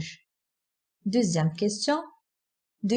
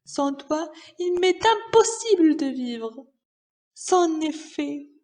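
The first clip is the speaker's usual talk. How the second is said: grief-stricken miser; 6 semitones higher than usual. Acted. Miser mourning his money like a dear friend, wailing despairingly.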